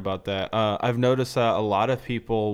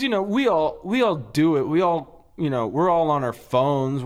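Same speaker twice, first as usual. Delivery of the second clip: ordinary talk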